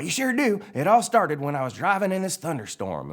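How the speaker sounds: high, loud, eager yarn-spinner; Mater. Acted. Mater starts his tale for McQueen, high eager voice. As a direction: launch into a tall tale in character. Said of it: "Mater's all".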